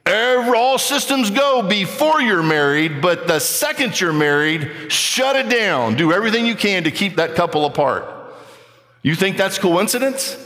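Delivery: high-pitched, emphatic preaching; impassioned preaching. Real, not acted. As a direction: preach it high and insistent, stretching key words. Preaches at full force.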